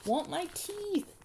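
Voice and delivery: high, drawn-out wail; screaming toddler. A narrator's reading. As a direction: wail slowly like a small boy demanding. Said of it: "Fudge started to scream".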